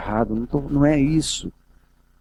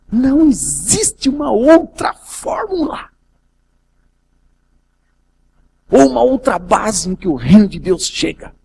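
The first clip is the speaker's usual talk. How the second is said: fervent preacher's peak, higher and louder; preaching at full force; 7 semitones higher, 4 dB louder than usual. Acted. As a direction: proclaim it to the church. Preaching climax.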